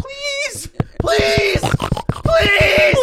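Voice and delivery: very high, squealed pleading; squealing piggy. Pretend. Friend squeals the piggy voice to crack up co-host, high squeal. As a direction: squeal like a frightened pig. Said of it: "You sound like a mon".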